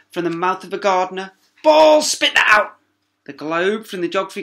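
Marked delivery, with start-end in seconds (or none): 1.6-2.8 high, loud barking exclamation; outraged shout. Acted. Teacher confiscates marbles, loud high outrage. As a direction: grab them in a fury.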